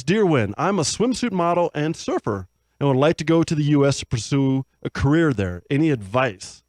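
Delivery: cheesy show host reading fan mail; upbeat announcer voice. Acted. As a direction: sell it like a radio show host. Host reads listener letter.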